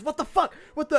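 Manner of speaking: high-pitched stunned exclamation; shocked outburst. Acted. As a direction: cry out as if just stabbed. Replaying stabbed spellcaster's outburst.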